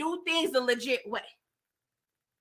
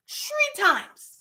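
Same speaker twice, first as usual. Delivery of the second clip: high-pitched, drawn-out emphasis; emphatic repeat; 8 semitones higher than usual. Real, not acted.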